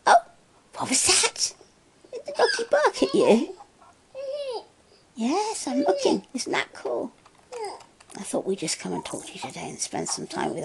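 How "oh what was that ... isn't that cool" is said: high, excited coo. Real, not acted. Talking to the baby.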